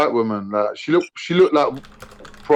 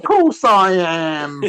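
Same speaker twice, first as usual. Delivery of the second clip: slow, flat-out film line; slow deadpan reply; 2 semitones higher, much slower than usual. Retold or imitated. Getaway driver answering in the car, very slow. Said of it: "And he's like".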